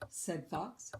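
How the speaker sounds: usual speaking voice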